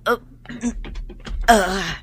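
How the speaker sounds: whimpering groans as she is lifted; moaning in pain onto the stretcher; pained groaning. Acted.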